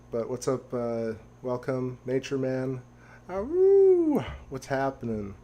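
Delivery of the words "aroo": high, loud howling aroo; wolf howl. Acted. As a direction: throw your head back and howl. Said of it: "I gotta practice my wolf howl".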